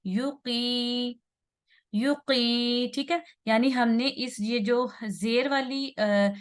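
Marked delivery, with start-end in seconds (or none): 0.0-2.9 "युकी" slow, with short yu and sustained qee; long second syllable. Shown as a demonstration. Teacher showing the two-harakat ya, drawn-out qee. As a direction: hold the ee of "युकी" for two beats. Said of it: "हरकत लंबा करेंगे".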